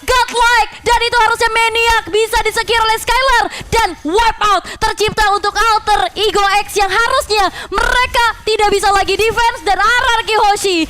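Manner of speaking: high, driving esports hype call; fighting game announcer. Acted. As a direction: shout the kills like a caster. Hyped teamfight play-by-play.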